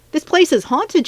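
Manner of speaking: high-pitched, fearful declaration; spooked student insisting on the danger; spooked freshman. A narrator's reading. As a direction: blurt it out, creeped out. Frightened freshman explains the haunting.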